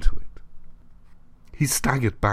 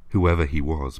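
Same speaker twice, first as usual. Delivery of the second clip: ordinary delivery, nothing marked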